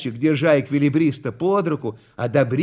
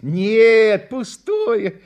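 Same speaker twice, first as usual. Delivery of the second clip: higher, lightly dismissive reply; modest; 7 semitones higher, 4 dB louder, much slower than usual. A narrator's reading. Contractor answers the schoolboy's question, modest. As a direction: wave the praise away. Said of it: "скромно ответил подрядчик".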